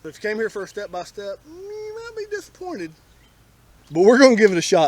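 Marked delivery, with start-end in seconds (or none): 1.5-2.9 high, slow teasing aside; wry high aside. Real, not acted. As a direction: drop the warning in a squeaky mock voice. Wry aside to step-by-step seekers.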